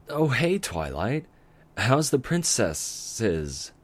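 slow, lightly voiced young dragon, teasing; childish teasing. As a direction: draw it out like a cheeky kid. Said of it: "with a childish chuckle".

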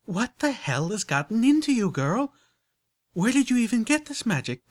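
high, loud, shocked country voice; alarmed gasp. A narrator's reading. Applejack confronting Rarity about the magic, shocked and loud. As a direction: recoil in alarm at her friend. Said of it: "Applejack gasped".

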